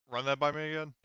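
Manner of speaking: normal talking voice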